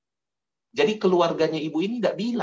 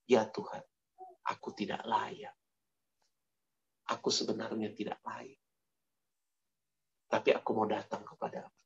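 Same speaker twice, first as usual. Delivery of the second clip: humble prayer; 3 semitones lower, 10 dB quieter than usual. Acted. Tax collector's humble prayer voiced.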